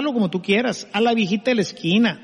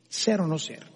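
quiet translation; 3 semitones lower, 8 dB quieter than usual. Shown as a demonstration. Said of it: "decía Shakespeare".

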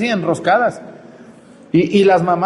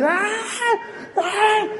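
imitating a child's shrill tantrum wail, pitched way up; mock child tantrum scream; 4 dB quieter than usual. Preacher mimics nephew's tantrum, mock high wail. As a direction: ham up a kid's screaming fit.

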